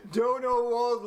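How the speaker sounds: usual speaking voice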